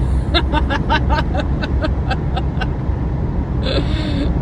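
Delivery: long, high run of real laughter; high staccato laughing fit. Real, not acted. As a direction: laugh helplessly at your own joke. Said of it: "I'm laughing".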